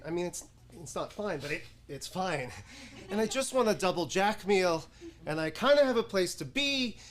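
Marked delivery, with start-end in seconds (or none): none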